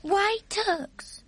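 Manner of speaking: put-on high, small girl voice asking; a young girl's puzzled question; curious little girl. Sophie questions the giant.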